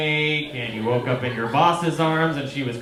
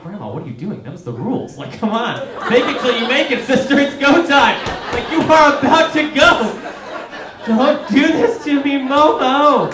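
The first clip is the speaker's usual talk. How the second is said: starts level, climbs to a high loud yell; begging dying grandma, rising into a frantic shout; panicked plea building to a shout; 10 semitones higher, 8 dB louder than usual. Retold or imitated. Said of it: "I'm like".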